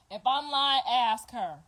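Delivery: usual speaking voice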